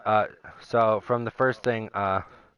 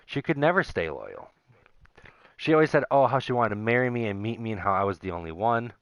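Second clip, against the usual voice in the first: normal talking voice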